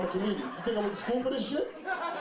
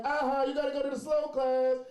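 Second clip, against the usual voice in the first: higher-pitched mocking sing-song; schoolyard taunt; 6 semitones higher than usual. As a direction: imitate kids teasing a classmate. Acting out classmates' taunt.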